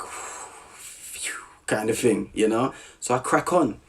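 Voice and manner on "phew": a soft whispered phew of relief; relieved phew. Retold or imitated. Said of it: "So I'm like".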